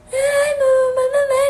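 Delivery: put-on high, loud, wailing child's cry; imitating crying child. Mother mimics her son crying for her to play, wailing high. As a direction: whine like a sobbing toddler begging for mum. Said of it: "dia sambil nangis".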